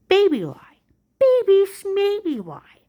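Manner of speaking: scornful singsong, slowing down; mocking. Real, not acted. Mocking the agent's lie.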